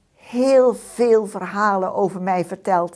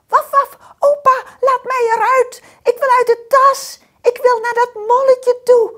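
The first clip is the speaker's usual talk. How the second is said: high, loud eager call; dog calling; 11 dB louder than usual. A narrator's reading. Dog calling to opa from the bag, high and loud. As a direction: beg loudly to get out. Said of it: "riep ik".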